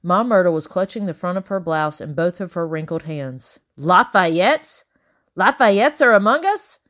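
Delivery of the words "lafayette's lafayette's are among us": loud, high rising alarm; aghast. A narrator's reading. Old Ma Myrtle learning who the guests are, aghast. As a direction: exclaim it in scandalised horror.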